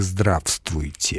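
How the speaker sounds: здравствуйте slow and soft, every written sound; spelling pronunciation. Shown as a demonstration. Expert showing how it looks in writing, здравствуйте sounded out slowly. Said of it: "на письме выглядит как".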